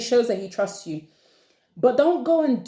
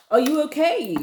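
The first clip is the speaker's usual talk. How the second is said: higher, incredulous check-in; mock concern; 6 semitones higher than usual. Asking a man watching at work, mock concern. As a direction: ask it high, as if baffled.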